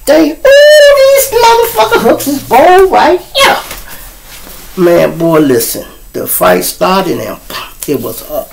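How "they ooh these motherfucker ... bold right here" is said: high loud mock voice; mock amazed. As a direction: play the wowed guy at the party. Imitating amazed partygoer.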